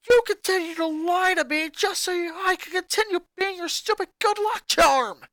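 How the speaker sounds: put-on high, loud furious boy shouting at family; a boy exploding at his mother; angry boy ranting. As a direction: snap back at Mom, furious. Lincoln furious rant.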